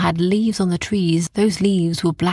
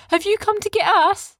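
put-on boys asking back. A narrator's reading.

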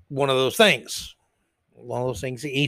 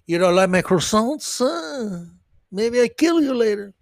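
higher-pitched, lilting bad French accent; 4 semitones higher than usual. Offended Frenchman threatens over croissants, in a mock French accent. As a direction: put on a hammy French accent. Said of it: "if that's a good French accent".

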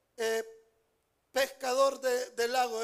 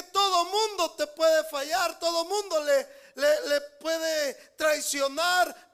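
preaching shout; 3 semitones higher, 8 dB louder than usual. Real, not acted. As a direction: thunder that he is faithful. Preacher proclaiming God's faithfulness.

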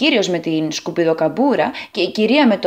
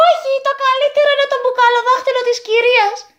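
put-on very high, fast giggly boy; boy's gleeful shout; faster than usual. A narrator's reading. Third boy mocks the lady's bottle finger, high and fast. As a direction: blurt it out like an excited kid. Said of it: "φώναξε το τρίτο αγόρι".

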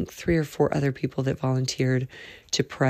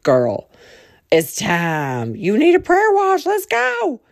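hammed-up pushy friend, much higher and louder; mock friend, high and loud; exaggerated friend voice; 10 semitones higher, 5 dB louder, slower than usual. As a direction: play up the bossy friend. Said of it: "That's not the way she talks".

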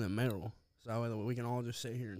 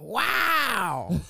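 imitated loud yell; screaming; 9 semitones higher, 11 dB louder than usual. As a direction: shout it out in mock excitement. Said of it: "screaming in the fucking background".

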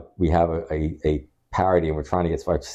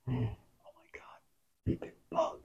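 whisper; 7 semitones higher, 14 dB quieter, much slower than usual. Real, not acted. Spotting bug on ceiling.

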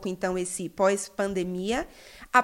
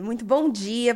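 ordinary talk, nothing marked

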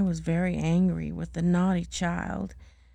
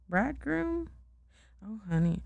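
plain reading voice

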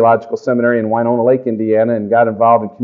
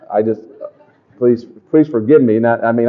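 ordinary talk, nothing marked

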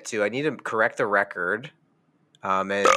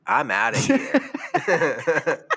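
laughing; 11 semitones higher, much slower than usual. Real, not acted. Laughing exit line.

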